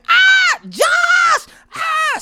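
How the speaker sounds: very high imitation scream; mock scream. Husband imitating his wife screaming from downstairs, high mock scream. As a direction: shriek the name in imitated panic. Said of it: "She starts screaming".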